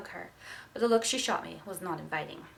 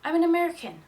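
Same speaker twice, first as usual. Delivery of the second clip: loud high shout, arms raised in surrender; 9 semitones higher, 11 dB louder, faster than usual. Acted. Naked boy shouts to the approaching police officer, loud and high. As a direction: blurt it out at full voice. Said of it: "raised my arms over my head and shouted".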